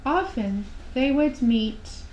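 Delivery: ordinary delivery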